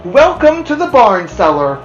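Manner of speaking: loud, high, formal greeting; loud ceremonious pig; Wilbur's speech. Acted. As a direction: Wilbur addressing the spiders with ceremony.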